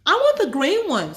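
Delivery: put-on high, bright little girl asking; a kid who wants the green one; high excited child voice; eager girl.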